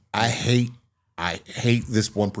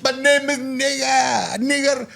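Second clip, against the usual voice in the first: high, slow, drawn-out self-introduction; imitating resort man; slower than usual. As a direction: mimic him announcing his name in a high voice. Acting out resort man introducing himself.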